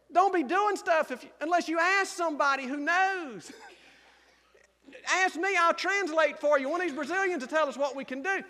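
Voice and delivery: usual speaking voice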